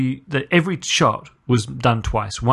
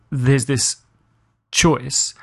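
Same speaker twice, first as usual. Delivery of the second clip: ordinary talk, nothing marked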